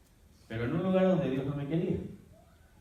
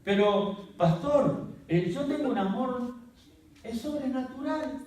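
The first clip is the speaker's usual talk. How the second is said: much higher, naive protest; mock church member; 9 semitones higher, slower than usual. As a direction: play the eager believer. Mock congregant objecting.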